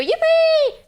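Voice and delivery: high loud cheer; excited squeal. Acted. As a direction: squeal with delight.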